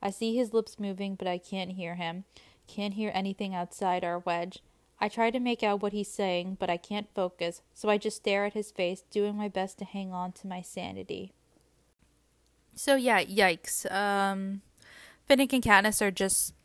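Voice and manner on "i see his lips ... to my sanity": soft, quiet reading aloud; story narration. A narrator's reading. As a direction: read the passage gently. Reading a passage from the book.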